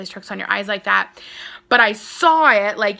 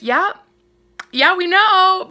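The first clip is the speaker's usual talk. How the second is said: weary family; 8 semitones higher, 6 dB louder, slower than usual. Retold or imitated. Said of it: "my family was just like".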